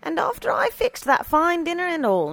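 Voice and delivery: high, put-out housekeeper complaint; pouting. A narrator's reading. Housekeeper grumbling over her rejected dinner, pouting. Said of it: "Missus Stoner pouted".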